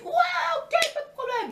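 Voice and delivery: very high, loud chirpy agreement; overeager girl. Acted. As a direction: squeal your yes. Blondine agrees happily.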